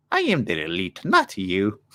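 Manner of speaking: lightly put-on, bad Irish accent; terrible Irish impression. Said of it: "That's a terrible Ireland impression".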